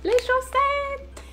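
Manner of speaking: très aigu et étiré, plus fort; révélation théâtrale. Pretend. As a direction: annoncer la surprise d'une voix perchée. Révèle théâtralement le colis. (présentation théâtrale suraiguë)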